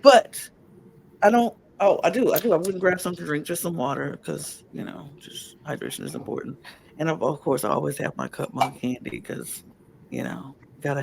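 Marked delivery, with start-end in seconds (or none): 4.2-6.6 raspy, nearly voiceless; hoarse whisper. Real, not acted. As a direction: push words out with no voice. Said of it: "husky, raspy voice".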